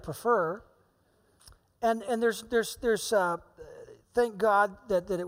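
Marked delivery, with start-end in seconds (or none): none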